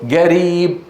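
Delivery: قريب dictated as "gariib"; hard g in place of the ق of قريب; ambiguous hard g. Shown as a demonstration. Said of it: "وتقول: اكتب".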